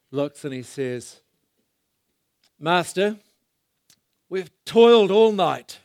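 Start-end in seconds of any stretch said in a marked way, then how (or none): 2.6-5.8 loud, higher, sarcastic Master; mock respect dripping with sarcasm; facetious Master. Preacher voicing Peter to the crowd, a sarcastic loud Master. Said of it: "it's a facetious master".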